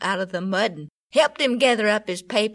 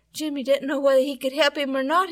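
usual speaking voice

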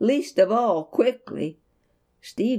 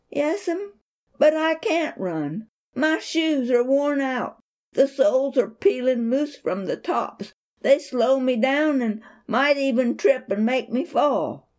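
put-on boy's voice pitched up, pleading; a worried boy explains; higher boy's voice; 6 semitones higher than usual. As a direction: son telling mother his shoes are worn out, boyish voice. Boy pleading about worn shoes.

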